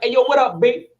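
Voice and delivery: a friendly Harlem yo; Harlem street greeting. Retold or imitated. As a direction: call out to your boy the way you grew up. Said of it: "We'd be like".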